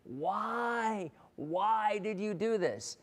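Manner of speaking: dying frog. Acted. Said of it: "the frog's like".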